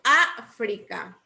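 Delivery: África, slow, with a punched Á; África slowly, opening syllable emphasized; stress on África's first syllable. Shown as a demonstration. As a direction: hit the Á and drop off on frica in África. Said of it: "Miren bien dónde lleva el acento".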